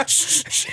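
sharp repeated shushes; quick hissed shushing; frantic shushing. Acted. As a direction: hush someone urgently. Guard hushing the parrot.

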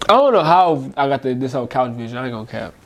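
ordinary talk

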